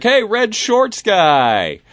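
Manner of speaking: high, drawn-out holler; calling out. Retold or imitated. Man hailing his drinking buddies at the pool, calling out. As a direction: holler it long and loud across a crowd.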